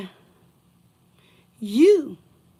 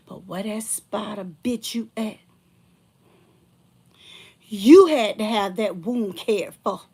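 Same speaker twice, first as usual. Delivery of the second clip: usual speaking voice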